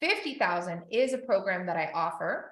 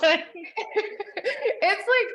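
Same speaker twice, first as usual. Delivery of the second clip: slow, higher, through laughter; laughing; 10 semitones higher, 4 dB louder, much slower than usual. Real, not acted. Wrapping up her discount story to Katie, laughing. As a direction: let the laugh carry the words.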